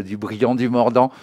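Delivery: ordinary delivery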